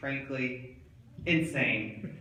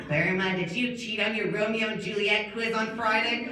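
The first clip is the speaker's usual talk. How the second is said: higher-pitched teacher voice; questioning teacher; 6 semitones higher than usual. Acted. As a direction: ask it as an oblivious teacher. Teacher questions mauled student.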